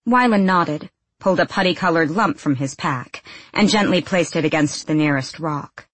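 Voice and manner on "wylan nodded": plain reading voice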